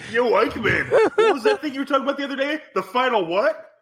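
high-pitched mock buddy call; playful high voice, on the edge of laughter; hammed-up comic role. Improvised Hitler calling to Eichmann.

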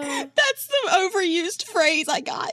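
high, tearful laughing voice; laughing fit. Real, not acted. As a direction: explain it while helpless with laughter. Explains through the laughing fit.

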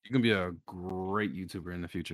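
low, soft, put-on solemn compliment; deep voice. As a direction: drop deep and bestow praise quietly. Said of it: "Bro, go, go deeper".

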